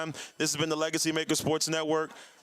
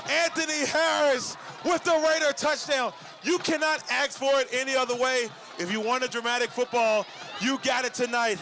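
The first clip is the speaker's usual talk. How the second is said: breathless excitement; 6 semitones higher than usual. Real, not acted. Announcer still buzzing after the touchdown, breathless and high. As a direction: keep the thrill in the voice.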